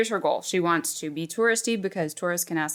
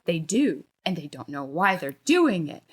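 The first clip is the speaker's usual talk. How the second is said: ordinary talk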